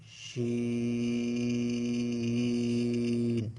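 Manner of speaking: শিন held long with a steady sh sound; drawn-out shin. Shown as a demonstration. Teacher showing students the letter শিন, drawn out. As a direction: stretch শিন slowly. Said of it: "এটাকেও টেনে উচ্চারণ করতে হবে".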